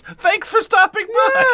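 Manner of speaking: very high, loud fake-friendly reply; cheery shop owner. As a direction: chirp it like a fake-polite owner.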